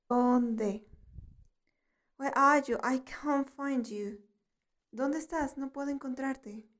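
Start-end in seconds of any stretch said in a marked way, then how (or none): none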